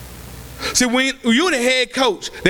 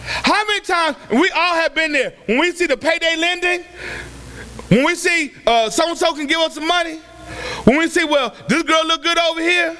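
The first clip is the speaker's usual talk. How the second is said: raised, ringing preacher's voice with shouted peaks; preaching at full force; 5 semitones higher than usual. Acted. Preacher urging the congregation to trust God's plan, fervent.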